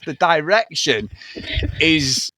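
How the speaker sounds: ordinary talk, nothing marked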